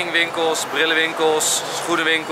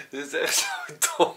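ordinary delivery

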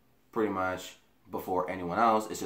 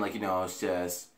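ordinary delivery

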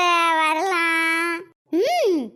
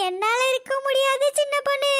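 ordinary talk

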